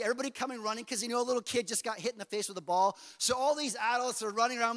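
ordinary delivery

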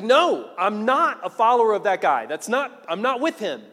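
loud, high angry denial; Peter. Acted. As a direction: disown him forcefully, word after word. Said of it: "Peter curses and is like".

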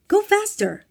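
loud, high, urgent yell; shouted order. A narrator's reading. Boss shouting at his assistant at the wheel. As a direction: yell the command at full urgency. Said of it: "Bob shouted at him".

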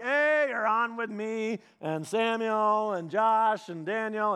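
slow, drawn-out roll call; announcing who's listening. Retold or imitated. Dad on speakerphone warning caller who's in the car, announcing. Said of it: "And usually he'd, he'd be like".

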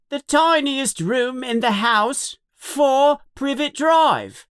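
high-pitched, called-out address; child's yell. A narrator's reading. Dudley reads the address to his parents, high yell. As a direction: call it out high, slowing at the end. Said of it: "he yells to his parents".

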